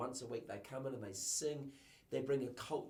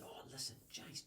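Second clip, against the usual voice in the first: shy quiet confiding; 9 dB quieter than usual. Retold or imitated. Young worker telling his boss the crowd loved him, hushed. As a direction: confide it softly, surprised at himself.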